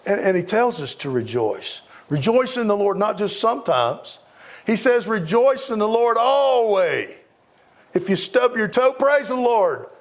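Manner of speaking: sermon climax, belting each line to the pews; full-force preaching. Acted. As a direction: rouse the congregation to rejoice.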